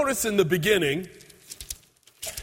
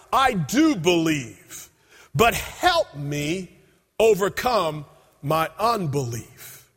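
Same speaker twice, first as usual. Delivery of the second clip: slow earnest plea; 2 semitones lower, slower than usual. Acted. Father cries out.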